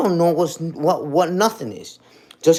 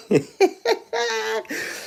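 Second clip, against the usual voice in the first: high cackling laugh. Real, not acted. Laughing at the haters on live.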